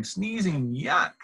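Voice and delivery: usual speaking voice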